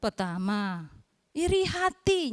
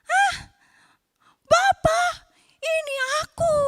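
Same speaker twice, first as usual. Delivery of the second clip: much higher, louder startled son; shocked; 14 semitones higher, 6 dB louder than usual. Acted. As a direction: gasp it out in disbelief. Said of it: "Si Esau lebih kaget".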